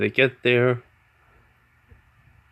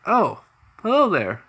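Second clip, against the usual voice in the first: cheery landlord; 10 semitones higher, 5 dB louder than usual. Acted.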